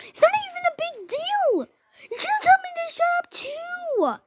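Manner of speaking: very high, loud acted yelling; screeched protest. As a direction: argue back in a high screech. Acted screaming argument with intruders.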